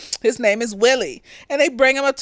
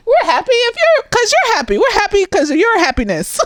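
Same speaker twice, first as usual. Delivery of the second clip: much higher and louder, saccharine; mock sweet humoring; 7 semitones higher, 4 dB louder than usual. Barbara and Melissa humoring Janine, high saccharine cheer. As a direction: sing the reassurance with fake cheer.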